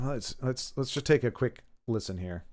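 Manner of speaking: usual speaking voice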